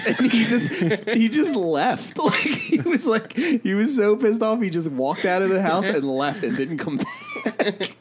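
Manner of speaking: words broken by laughter; giggling through the story; laughing. Real, not acted. Laughing while telling story.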